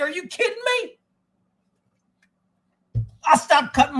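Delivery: can't believe the time; shocked. Real, not acted.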